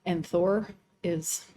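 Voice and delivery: ordinary talk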